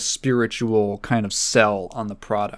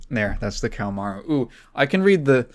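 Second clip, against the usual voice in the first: normal talking voice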